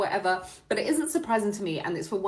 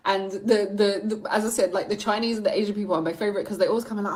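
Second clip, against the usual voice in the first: ordinary talk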